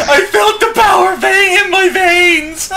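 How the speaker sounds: high, slow, laughing proclamation; laughing mock shout. Real, not acted. Echoing the friend's line, laughing high and slow. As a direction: bellow it through laughter.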